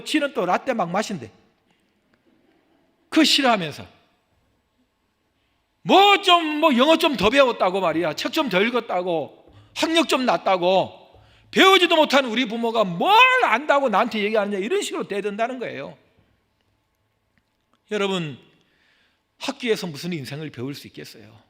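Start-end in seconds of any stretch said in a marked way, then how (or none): 5.8-16.0 a hint of a sneering kid talking back, loud; mock defiant youngster. Preacher mocking children who talk back, loud. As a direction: mimic a cheeky child sassing parents.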